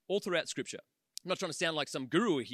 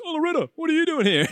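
mock greeting; 8 semitones higher, 8 dB louder, faster than usual. Replaying the camcorder audio.

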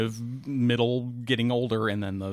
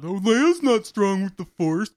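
higher, dragged-out complainer voice; whiny, drawn-out mockery; mock whining; 6 semitones higher, slower than usual. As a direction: imitate a whiny fan griping. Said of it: "I heard people whining and bitching about".

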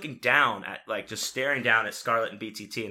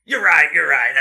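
very loud, raised voice breaking into a laugh; retold outburst of giving in; loud acted-out concession; 4 semitones higher, 13 dB louder than usual. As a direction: blurt agreement at full volume. Acting out giving in when held back.

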